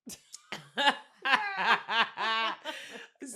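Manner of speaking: big, loud burst of laughing; long, real belly laugh; loud laughter. Real, not acted. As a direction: crack up hard. Burst of laughter mid-story.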